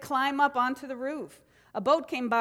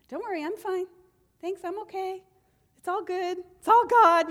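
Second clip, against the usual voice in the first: higher, airy fake-fine voice, rising to a loud "It's all God."; mocking breezy cheer; 7 semitones higher, 5 dB louder than usual. Minister mocking people who pretend they're fine, in a higher fake-cheerful voice. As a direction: parrot the brush-off as if nothing's wrong. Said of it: "You know how I hate when people say that".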